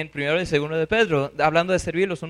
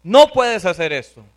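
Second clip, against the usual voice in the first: loud, forceful forbidding; very angry; 7 dB louder than usual. Acted. Peter orders his Lord not to do it, very angry. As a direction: lay down the law to a superior, loudly. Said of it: "se puso muy bravo diciendo".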